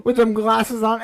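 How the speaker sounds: wheezing through laughter; real helpless laugh; laughing hard. Real, not acted. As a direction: talk while cracking up. Laughing at friend's glasses.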